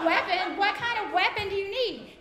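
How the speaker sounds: can't believe what you hear; incredulous. Retold or imitated. Incredulous mom.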